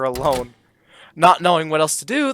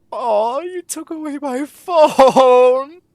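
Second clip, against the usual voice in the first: mock whine; 10 semitones higher, 6 dB louder, much slower than usual. Player whines over lost phone.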